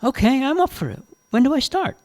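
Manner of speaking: put-on high, keen young woman; eager young Jane. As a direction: jump at the chance, pitched high. Jane eagerly accepts the job.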